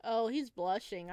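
normal talking voice

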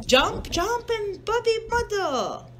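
high sing-song hippo voice; bouncy little hippo chant; lightly voiced Hippo. Mother to child, reading a picture book, lightly voiced hippo. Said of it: "She's like".

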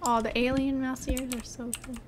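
ordinary talk

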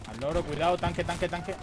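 loud, rising alarm call; urgent warning shout. Real, not acted. Calling out tank to squad.

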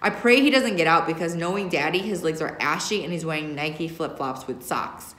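usual speaking voice

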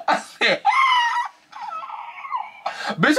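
very high, loud laughing shriek; hysterical laughing. Real, not acted. Reacting to the age reveal, shrieking with laughter. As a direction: lose it laughing in disbelief.

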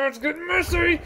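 high excited yell; excited shout. Real, not acted. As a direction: call it out, thrilled. Combat starts, excited shout.

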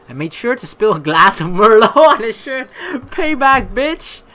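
laughing, high and loud; gleeful laugh breaking into the words; amused gloating. Real, not acted. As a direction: crack up over petty revenge. Amused by petty revenge in game.

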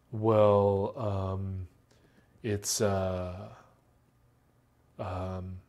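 demonstrating fillers, trailing and unsure; hesitant fillers. Shown as a demonstration. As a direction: hesitate and search for words. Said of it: "those are called fillers".

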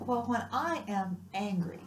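ordinary talk

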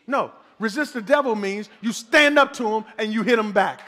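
ordinary talk, nothing marked

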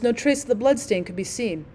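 ordinary delivery, nothing marked

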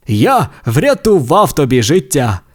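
proud tow-truck voice. Acted. Tow truck boasts he saved his friend. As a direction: claim the credit. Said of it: "гордо відповів Сирник".

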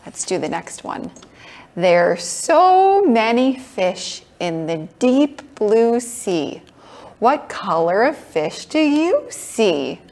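bright, sing-song preschool teacher; kids show host. Acted. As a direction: coax little ones along gently. Leads toddlers in a kids' class.

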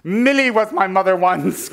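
dramatic refrain. Acted. Dramatic refrain about past mothers.

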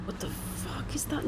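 tense unvoiced whisper; real frightened whisper. Real, not acted. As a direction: hush it so nothing hears. Creeping through spooky woods.

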